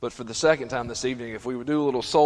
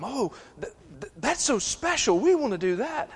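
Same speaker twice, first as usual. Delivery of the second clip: envious Israelites; 10 semitones higher than usual. Acted.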